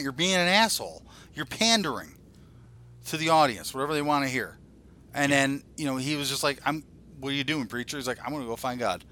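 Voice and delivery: ordinary talk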